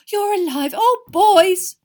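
high, loud emotional cry; overcome with relief. A narrator's reading. Mother to her sons, crying out in relief. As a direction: wail with joy at seeing them.

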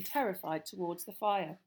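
plain reading voice

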